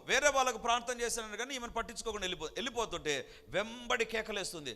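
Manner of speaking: normal talking voice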